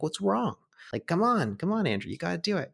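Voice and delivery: lightly voiced eager boy, urging; imitating an eager kid. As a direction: egg him on like a pushy ten-year-old. Kid friend coaxing from tire.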